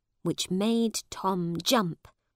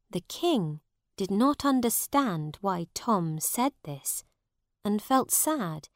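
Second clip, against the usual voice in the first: usual speaking voice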